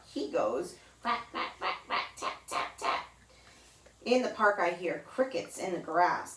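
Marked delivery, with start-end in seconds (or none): 1.0-3.1 whispered tapping like a little hammer; woodpecker tapping. Retold or imitated. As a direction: tap it out softly like a woodpecker. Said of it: "sounds like a little hammer".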